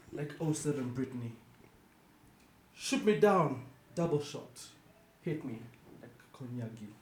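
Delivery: measured spoken-word delivery with long pauses; poetry recital. Acted. Host performs an unhinged poem for co-hosts, poetry recital. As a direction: deliver each short line as a separate beat.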